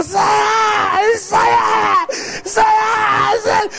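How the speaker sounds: high shouted strikes; mock ninja yells. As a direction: whip each word like a ninja blow. Mother whipping in ninja style.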